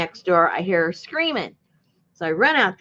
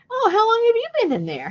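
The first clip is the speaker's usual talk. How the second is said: polite voice; 4 dB louder than usual. Retold or imitated. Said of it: "so I asked the guy".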